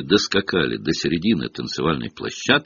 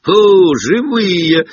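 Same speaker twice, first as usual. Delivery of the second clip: much higher, louder, slow staged amazement; mock surprised exclamation; 11 semitones higher, 7 dB louder, much slower than usual. A narrator's reading. Sir Patrick exclaiming at the living, big fake surprise. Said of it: "воскликнул сэр Патрик".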